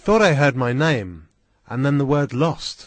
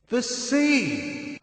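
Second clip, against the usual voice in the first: amazed cry; 11 semitones higher than usual. A narrator's reading. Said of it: "I cried".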